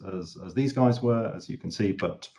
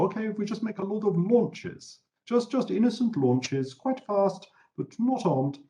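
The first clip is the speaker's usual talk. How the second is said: higher, sing-song fake innocence; mock innocent Germans; 12 semitones higher than usual. Germans assuring treaty watchers the launches are harmless, mock innocent. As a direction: play it butter-wouldn't-melt.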